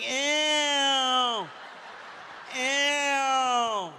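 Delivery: lightly voiced grossed-out girls; a hint of girls going ew; little girls disgusted. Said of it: "I can hear little girls like".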